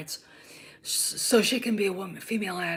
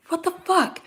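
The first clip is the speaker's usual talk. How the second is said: much higher, incredulous; retold disbelief; 9 semitones higher, 3 dB louder than usual. Recalling her own reaction to the show, incredulous. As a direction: sound stunned by what you're watching.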